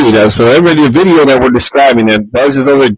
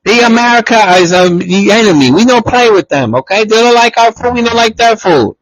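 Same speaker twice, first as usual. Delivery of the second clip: higher-pitched stern parent lecture; mock strict parent; 9 semitones higher than usual. Parent lecturing a child about Americans, mock stern. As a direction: play the disapproving parent forbidding American friends.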